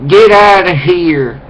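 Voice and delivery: high, loud, drawn-out mock yell; imitated zombie-like woman. Speaker replaying the woman's order, slow mock zombie yell. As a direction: stretch every word in an exaggerated squawked order. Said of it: "she's going like this".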